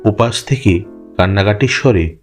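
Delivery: plain reading voice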